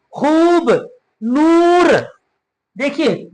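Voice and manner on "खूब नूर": खूब and नूर with long, held oo; drawn-out vowel. Shown as a demonstration. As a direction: stretch the oo in खूब and नूर, pitched up. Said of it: "खींचा हमने".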